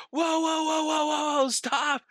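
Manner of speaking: mock panicked yell to halt the truck; alarmed shout. [name] yelling at the driver, high alarmed shout. Friend shouting as Todd flies.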